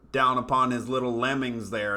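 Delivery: ordinary delivery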